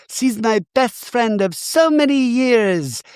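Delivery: high, breathy-free squeaky mock Jennifer; high mock Jennifer Tilly gushing over a tarot card. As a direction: gush like a ditzy star.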